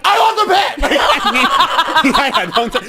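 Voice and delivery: high, loud starstruck gush with laughter; squealing fan meeting a star. Retold or imitated. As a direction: blurt it out like a giddy fan. Fangirling at celebrity, laughing.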